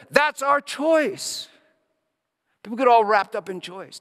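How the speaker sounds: rising, swelling pulpit voice; impassioned sermon cadence; fervent preaching. Acted. Preaching on forgiveness and grace.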